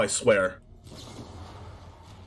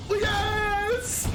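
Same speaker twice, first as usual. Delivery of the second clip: higher, a real cry of joy; excited yes; 6 semitones higher than usual. Real, not acted. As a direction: cheer at the winning draw. Big game moment cheer.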